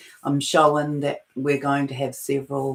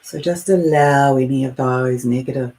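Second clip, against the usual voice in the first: guided meditation; 7 dB louder than usual. Acted. Meditation leader inviting listeners to release negative thoughts, guided meditation. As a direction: guide them firmly and unhurriedly into letting go.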